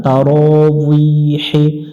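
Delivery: very slow তারাউইহি with a w sound, not b; drawn-out তারাউইহি; Arabic pronunciation. Shown as a demonstration. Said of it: "তারাবি পড়া যাবে না".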